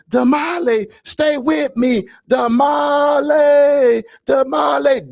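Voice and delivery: very high, slow stretched-out cries; imitated screaming. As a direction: wail the name as if begging someone to hold on. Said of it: "Then he starts screaming this".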